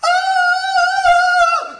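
long, high sustained scream; imitated scream. Preacher mimics the little mutant lady that screams, long high scream. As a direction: let out a loud, piercing mock scream. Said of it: "she screams. She's scared outright. She goes".

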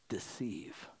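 a dramatic hushed whisper on deceive; hushed reveal of a hidden word. Acted. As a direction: drop to a breathy secret whisper. Reveals hidden meaning of neglect.

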